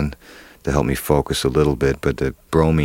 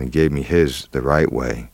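ordinary delivery

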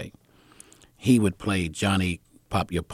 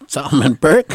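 louder, higher startled echo; startled question; 7 semitones higher, 12 dB louder than usual. Retold or imitated. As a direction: blurt it back as a question. Echoes back boss's question in alarm.

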